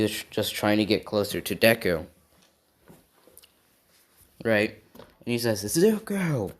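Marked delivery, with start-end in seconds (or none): 5.6-6.5 high, drawn-out call of the name; mild mock yell. Bakugo calling out to his rival, mild acted yell. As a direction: call it out high, without full volume. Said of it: "He yelled that at him".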